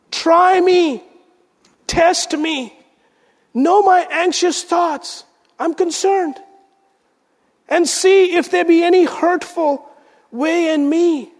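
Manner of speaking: high, pleading psalm reading; fervent pleading recitation; impassioned prayer. Acted. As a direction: cry the prayer up to God.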